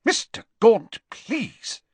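shocked. Acted. Official protesting to the abusive father, shocked. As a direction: object in shock, pitched well up. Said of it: "said Ogden in a shocked voice".

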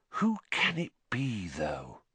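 soft musing, falling away at the end; quiet voice. A narrator's reading. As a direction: wonder aloud, hushed. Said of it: "she said in a quiet voice".

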